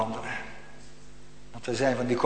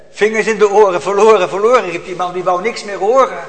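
loud, higher cry; preaching at full force; 6 semitones higher, 11 dB louder than usual. Acted. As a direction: call it out fervently. Preacher rising again.

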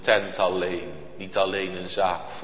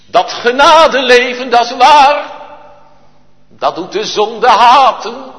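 high, loud preacher's call; preaching at full force; 17 dB louder than usual. Acted. Preacher raising his voice to the congregation, high and loud. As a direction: build to a ringing cry. Sermon climax.

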